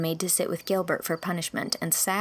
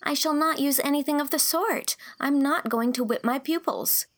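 much higher, firm refusal; indignant young girl; 8 semitones higher than usual. Acted. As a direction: protest earnestly. Girl protesting firmly.

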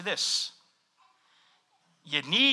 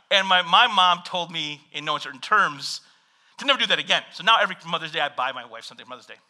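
ordinary delivery

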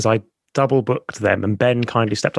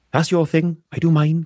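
lightly put-on Welsh accent, a little higher; 4 semitones higher than usual. Wife brushing off husband's podcast, half-hearted Welsh accent. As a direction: imitate a Welsh wife, accent not very good. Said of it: "'cause she's Welsh".

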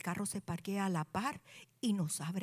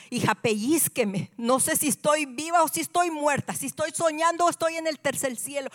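overwhelmed disbelief; 7 semitones higher, 7 dB louder than usual. Acted. Overwhelmed, begs daughter to pinch her.

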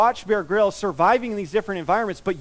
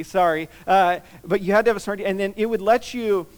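usual speaking voice

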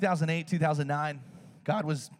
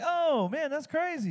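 high-pitched amazed reaction; "no way" energy, voice soaring; mock amazement; 12 semitones higher, slower than usual. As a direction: react like it's wild news, pitched way up. Acted amazed reply in retold dialogue.

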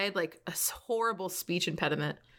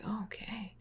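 breathless, much softer whisper; stunned whisper; 3 semitones higher, 9 dB quieter than usual. Retold or imitated. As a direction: let it slip out in quiet disbelief. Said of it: "I'm like".

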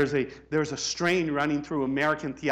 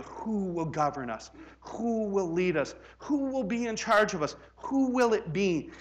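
rising rhetoric; 7 semitones higher, 3 dB louder than usual. Acted. Building rhetorical questions.

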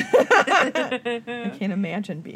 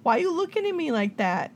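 high, playful talk to the pet; 9 semitones higher, 4 dB louder than usual. Real, not acted. Greeting Tallulah the pet in a high playful voice. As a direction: coo up at the dog.

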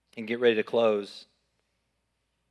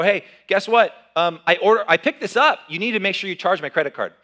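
exasperated call; 7 semitones higher, 8 dB louder than usual. Retold or imitated. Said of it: "and I go".

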